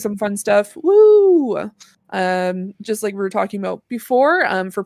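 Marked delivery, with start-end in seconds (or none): 0.8-1.7 high, loud "woo" cheer; celebratory whoop. Real, not acted. Host cheers the start of vacation, celebratory whoop. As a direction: let out a quick party whoop.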